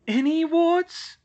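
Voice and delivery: very high, loud, slow disbelief; young witch stunned at the news; shocked gasp of a question. Acted. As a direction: gasp it out in horror. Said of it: "Hermione gasped".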